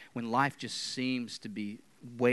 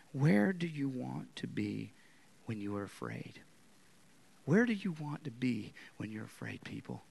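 normal talking voice

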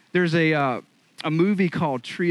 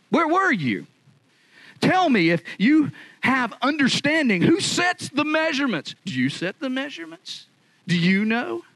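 much higher, fervent preaching roar; thundering God; 10 semitones higher than usual. Acted. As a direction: hammer each question at Job. Preacher voicing God questioning Job.

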